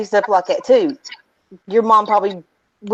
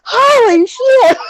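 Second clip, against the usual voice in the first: high, drawn-out laughing cry; laughing exclamation; 10 semitones higher, slower than usual. Real, not acted. Cracking up with friends over hairballs, high laughing exclamation. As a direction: exclaim slowly through real laughter.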